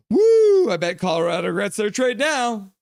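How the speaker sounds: huge high whoop; goal cheer. Real, not acted. Streamer cheering a goal, loud high whoop. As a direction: let out a big goal cheer.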